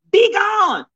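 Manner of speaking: high preacher cry; mock banishing. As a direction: cast it out.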